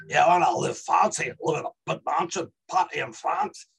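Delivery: Glaswegian Scots accent, swinging pitch; Glasgow accent. Shown as a demonstration. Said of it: "A Glasgow accent".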